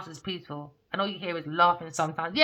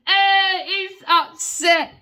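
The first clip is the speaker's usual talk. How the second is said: gleeful laughter; 10 semitones higher, 11 dB louder, much slower than usual. Real, not acted.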